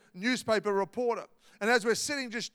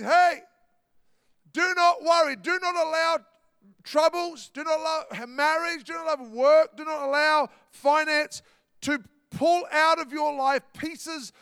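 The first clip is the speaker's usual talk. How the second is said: emphatic exhortation; 5 semitones higher than usual. Acted. Preacher voicing Jesus' exhortation.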